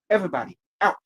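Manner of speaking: loud order. Real, not acted. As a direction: clear everyone out loudly. Ordering people out of room.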